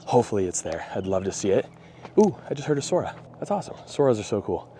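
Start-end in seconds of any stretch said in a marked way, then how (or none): 2.2-4.8 excited. Real, not acted. Hears a rare bird.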